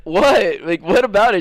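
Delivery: high, loud baffled question; retold bafflement. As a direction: imitate a friend who is totally confused. Retold friend's baffled reply.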